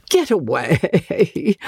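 slow, coy brush-off with a high giggle; a flattered dandy waves it away; pleased, mock modest. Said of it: "quite pleased".

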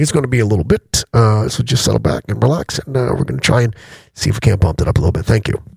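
low, easy captain on the intercom; deep relaxed airline pilot PA; pilot announcement voice. Retold or imitated. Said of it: "It's like".

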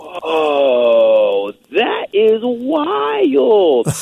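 drawn-out amazed gasp of wonder; awestruck. Real, not acted. Moved hearing song comparison.

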